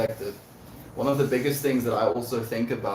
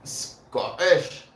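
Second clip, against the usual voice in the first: higher, exaggerated Scottish accent; Scottish accent attempt; 7 semitones higher, 4 dB louder than usual. Shown as a demonstration. Streamer trying to mimic Scottish on the word, mock Scottish accent. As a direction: put on a rough Scottish accent. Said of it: "mimic a Scottish accent".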